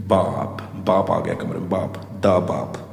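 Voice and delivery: low, soft ominous murmur; ominous hush. Acted. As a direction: build dread as the father arrives.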